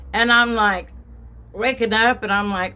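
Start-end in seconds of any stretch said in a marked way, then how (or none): none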